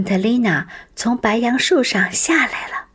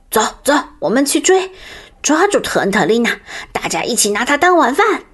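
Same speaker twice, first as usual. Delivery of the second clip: eager wolf; 4 semitones higher, 3 dB louder, faster than usual. Acted. Wolf urges pack to chase.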